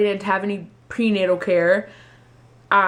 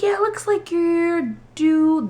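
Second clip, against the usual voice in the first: slow high tech voice; 10 semitones higher, 3 dB louder, slower than usual. Retold or imitated. Tech giving due date.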